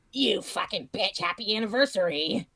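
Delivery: mock hater quote. Quoting a hater's nasty comment.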